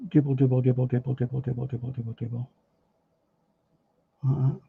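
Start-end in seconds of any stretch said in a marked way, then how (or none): 0.1-2.5 softer and softer with each dribble, down to near silence; fading away. Shown as a demonstration. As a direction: let the repeated dribbles trail off as the brush works. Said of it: "just dribble".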